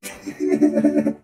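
helpless giggling that fades out; real laughter. Real, not acted. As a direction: burst out laughing, then wind down. Laughing during quack game.

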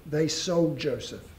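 ordinary talk, nothing marked

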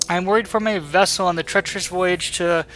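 lightly voiced worried merchant; voiced ship merchant. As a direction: read it as a nervous trader. Reads merchant quest text.

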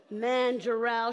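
normal talking voice

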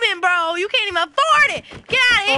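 high, loud trash talk; taunting yell. Pretend. Player taunts an opponent in the game, taunting yell. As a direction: rise into a high shout.

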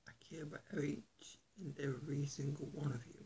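soft whispered heartfelt words. Real, not acted. Vlogger whispering to his viewers, sincere and soft. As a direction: breathe it out gently to your viewers.

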